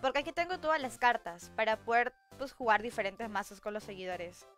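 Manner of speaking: ordinary talk, nothing marked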